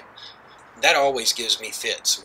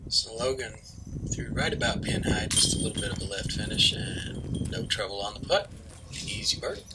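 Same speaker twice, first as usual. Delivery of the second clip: golf commentator; 6 dB quieter than usual. Acted. Hushed disc golf call.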